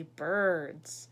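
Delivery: low, loud hushed reveal; mysterious. Acted. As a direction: drop it low like a secret. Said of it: "said the frog mysteriously".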